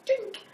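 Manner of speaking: very high little "dink"; imitated laser sound. Shown as a demonstration. Showing how a laser hits hair, high imitated ping. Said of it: "Your sound effects also need work".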